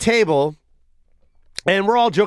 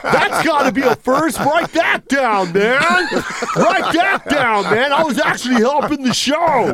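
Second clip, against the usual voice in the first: mock indignant heckler; 6 semitones higher than usual. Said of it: "and they're like".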